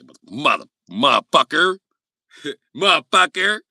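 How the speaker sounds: high, loud stammered curse. Retold or imitated.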